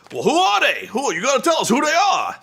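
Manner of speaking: a hammed-up pushy demand for the answer; imitating Taz. Mocking Taz pressing Eric for names, high and pushy. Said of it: "Taz is like".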